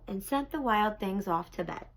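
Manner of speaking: plain reading voice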